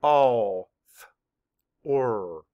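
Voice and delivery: All, th, or each said as a separate sound; segmented sounds of author; sounds mapped apart. Shown as a demonstration. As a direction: tap out All, th, or one sound at a time. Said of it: "Map the sounds".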